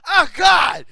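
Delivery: high, full-volume agonized cry; loud mock wail. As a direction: burst out wailing, imitating her. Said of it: "And then she'd get loud again".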